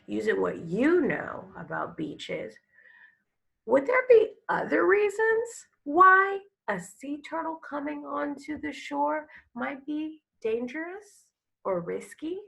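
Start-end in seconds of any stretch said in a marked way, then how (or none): none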